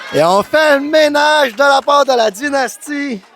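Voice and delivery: lively ringside play-by-play; wrestling commentator. Acted. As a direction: call the match with building excitement. (commentateur de lutte enflammé)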